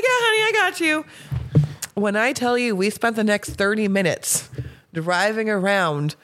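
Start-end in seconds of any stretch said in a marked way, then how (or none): 0.0-1.0 high, sweet mock reassurance; reassuring. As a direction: soothe her like a friend. Retold reassuring the drunk girl.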